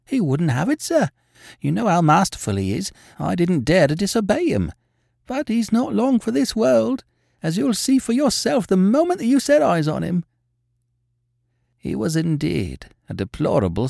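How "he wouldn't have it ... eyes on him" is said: high, agitated landlady voice; distressed landlady. A narrator's reading. Landlady telling how she insisted on a doctor, agitated. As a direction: pour out the worry, higher and urgent.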